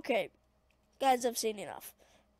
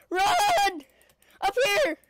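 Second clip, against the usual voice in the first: loud high urgent shout; 7 semitones higher, 6 dB louder, much slower than usual. Real, not acted. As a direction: shout it in a panic. Calling out in game.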